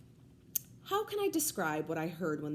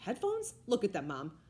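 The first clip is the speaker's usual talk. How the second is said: angry. A narrator's reading. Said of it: "I said angrily".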